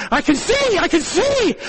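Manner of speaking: high, excited cry of wonder; amazed. Retold or imitated. Inmate tells the preacher he can see, excited. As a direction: retell his amazed discovery. Inmate suddenly regains sight.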